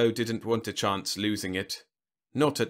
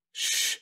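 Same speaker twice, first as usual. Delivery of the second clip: sharp hushing hiss; shushing. Acted. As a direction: hush them with a long shh. General hushes his companion.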